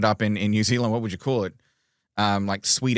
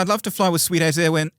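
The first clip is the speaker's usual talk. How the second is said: put-on first go at a Kiwi accent, pitched higher; tentative Kiwi accent; 7 semitones higher, 3 dB louder than usual. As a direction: ease into a New Zealand accent. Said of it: "Can you do a Kiwi accent?".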